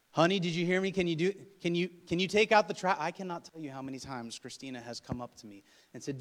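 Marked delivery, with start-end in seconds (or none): 0.1-3.0 raised, insistent mock-wife voice; nagging wife. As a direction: keep restarting the request, louder and higher. Wife nagging a husband, acted.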